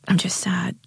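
breathy, voiceless confession of sadness; sad whisper. A narrator's reading. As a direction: whisper it as if it hurts to admit. Jade finally admits sadness, whispered.

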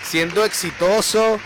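loud, effusive radio-host praise; congratulatory. Real, not acted. Host hailing a successful caller, loud congratulations. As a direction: hail him like a champion.

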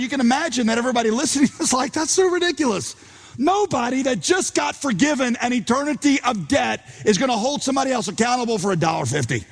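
high, fast disbelief; incredulous. Acted. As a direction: sound amazed at how ridiculous it is. Incredulous listeners voiced.

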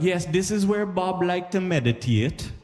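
high, slow, reverent guide voice; hushed solemn tour-guide act. Retold or imitated. Jamaican tour guide showing [name]'s house, high slow reverent. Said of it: "So they'll be like".

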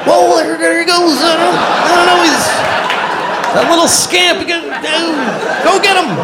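very high, hammed-up cluelessness; high-pitched pretend search. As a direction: act baffled in a squeaky high voice. Acts out pretending dog still lives.

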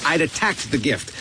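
usual speaking voice

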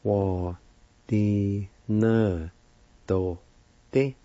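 "Wa, di, ne, do, de" each syllable spoken apart. Shown as a demonstration. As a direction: sound out "Wadinadoti" one syllable at a time. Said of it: "Second part".

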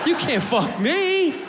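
slow, drawn-out mock war cry; mock defiant yell. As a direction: bellow it like a triumphant tourist. Mock defiant tourist yelling.

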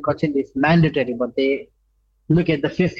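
ordinary talk, nothing marked

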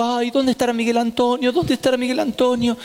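mother feigning worry while waiting to ambush her son; fake worry. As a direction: pretend to be worried, looking around. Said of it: "yo me hago la preocupada".

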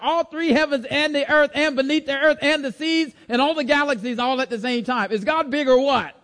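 high, building preacher's cadence; fervent preaching. Acted. Preacher telling congregation God fills heaven and earth, fervent preaching.

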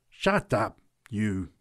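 mildly growled order; a touch of threatening growl. A narrator's reading. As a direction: growl a warning at him. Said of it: "growled the big woman".

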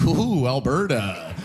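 mock-concerned, drawn-out reaction; wary outsider. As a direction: imitate an outsider taken aback, stretching the words. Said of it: "when you're outside looking in".

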